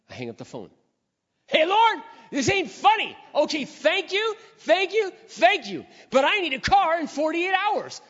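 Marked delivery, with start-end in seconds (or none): 1.5-8.0 high, loud, half-laughing plea to the Lord; frantic loud prayer. Retold or imitated. A man with a job but no car crying out to the Lord, high and loud. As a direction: shout thanks then panic up at heaven. Retold prayer after the phone call.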